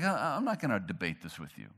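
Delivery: low, soft, unbothered refusal; calm Jesus. Retold or imitated. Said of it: "Jesus is like".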